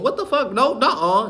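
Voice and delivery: mock a shocked denier; squeaky put-on refusal; mock disbelief. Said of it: "I know someone saying".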